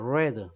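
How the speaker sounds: "read" said as "red"; short e in "read", rhyming with bed; past-tense read. Shown as a demonstration. Teacher models the past pronunciation, "read" as "red". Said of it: "요게 과거 발음이에요".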